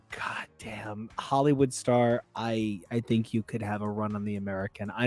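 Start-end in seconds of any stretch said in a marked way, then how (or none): 0.1-1.1 unvoiced whispered curse. Real, not acted. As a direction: breathe the curse softly. Whispered aside.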